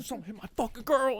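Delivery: high-pitched imitation of a tweaking hothead; agitated man ranting. Man tweaking about his girl, agitated mock rant. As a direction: act out a man worked up over his girl. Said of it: "tweaking about someone punching his girl".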